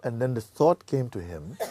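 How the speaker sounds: usual speaking voice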